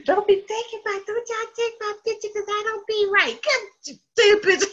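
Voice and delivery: high, fussy mock-mother protest; imitating mother. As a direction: whine it like mom dodging the camera. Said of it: "She gonna say".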